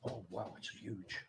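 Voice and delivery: soft amazed breath; hushed in wonder; awed hush. Real, not acted. Entering the huge bedroom.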